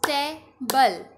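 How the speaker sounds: Table in two slow, separated beats; split into syllables. Shown as a demonstration. Teacher leading a clapping drill, table split in two. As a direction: leave a clear gap inside table. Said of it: "partition में इसे बोलना है".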